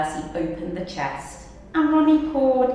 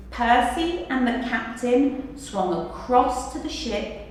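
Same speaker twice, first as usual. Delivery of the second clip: plain reading voice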